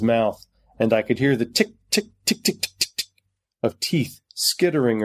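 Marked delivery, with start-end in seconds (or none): none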